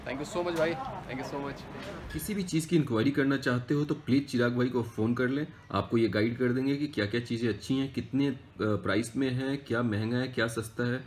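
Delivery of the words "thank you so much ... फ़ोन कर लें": ordinary delivery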